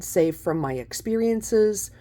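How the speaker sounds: usual speaking voice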